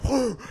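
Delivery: loud, high imitated dog growl. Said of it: "the dog's like".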